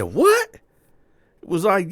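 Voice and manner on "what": very high, loud stunned yelp; squeaky astonished what; shocked what. Retold or imitated. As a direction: jolt up in disbelief. Shocked by booty snatcher news.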